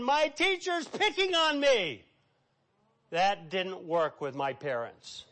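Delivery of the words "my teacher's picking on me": put-on whiny crying child. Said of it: "I come home and I'm crying".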